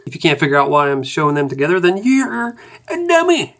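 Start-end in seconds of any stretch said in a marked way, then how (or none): 1.8-3.5 teasing. Acted. Teasing the viewer.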